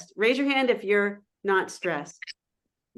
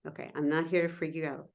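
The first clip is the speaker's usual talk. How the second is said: ordinary delivery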